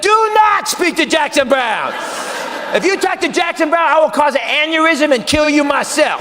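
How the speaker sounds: frantic warning from the earpiece; warning shout. Retold or imitated. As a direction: bark the order in his ear. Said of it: "my ear said".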